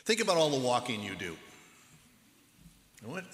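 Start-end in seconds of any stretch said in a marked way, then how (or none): none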